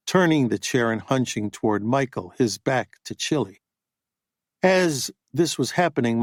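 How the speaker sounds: ordinary delivery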